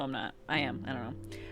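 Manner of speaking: usual speaking voice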